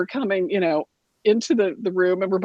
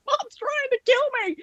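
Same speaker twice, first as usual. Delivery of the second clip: very high imitation of a hysterical sister; hammed-up victim's shriek; mock panicked wail. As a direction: squeal it as an exaggerated plea for rescue. Acts out sister crying for help.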